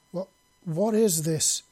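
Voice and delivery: startled exclamation. Acted. Ciri startled by Jack's touch, loud and slow. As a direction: caught off guard by a sudden vision. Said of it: "she exclaimed".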